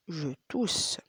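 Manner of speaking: whisper. Shown as a demonstration. Teacher whispers the phrase on the repeat. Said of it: "whispered take after voiced one".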